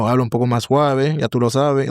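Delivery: smooth, relaxed Caribbean delivery; Caribbean Spanish. Shown as a demonstration. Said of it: "in the Caribbean".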